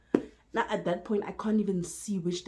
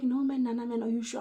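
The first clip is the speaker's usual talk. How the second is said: retold protest; 5 semitones higher, 5 dB louder than usual. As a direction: voice the reluctant partner, higher and louder. Retold reluctant reply from mom's partner.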